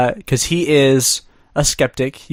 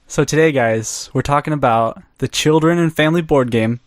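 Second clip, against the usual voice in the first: ordinary talk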